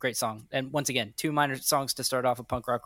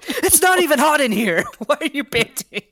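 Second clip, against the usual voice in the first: much higher, louder put-on voice of an owner fed up with the dog; mock exasperated owner; 13 semitones higher, 11 dB louder than usual. Mock complaint to panting dog.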